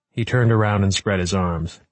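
plain reading voice

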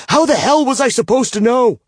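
high, loud furious shout. A narrator's reading. Bound man to his captor, furious shout. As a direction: yell it in her face. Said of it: "I shout".

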